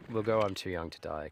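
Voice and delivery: usual speaking voice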